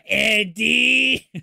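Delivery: loud high call of the name. Acted. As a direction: yell the name out across the room. Cohost blurts a joke answer.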